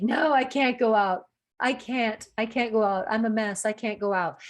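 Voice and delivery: hammed-up self-pity turning friends down; mock whiny refusal. Heartbroken woman refusing her friends, hammed-up whine.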